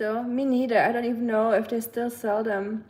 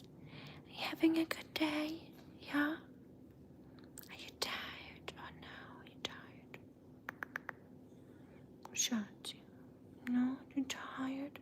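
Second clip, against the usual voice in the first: soft whisper to a sleepy pet; hush, coo to a tired puppy; 4 semitones higher, 16 dB quieter, slower than usual. Real, not acted.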